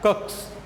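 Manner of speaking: teacher's phonics 'कक्स'; letter-sound demo. Shown as a demonstration. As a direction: sound out 'कक्स' with a voiceless ks ending. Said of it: "में भी क्या आ रहा है".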